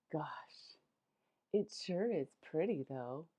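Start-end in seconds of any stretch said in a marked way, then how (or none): 0.1-0.8 breathy awed whisper; a soft gasping whisper. Acted. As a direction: hush it like a secret. Whispering as if elves might hear.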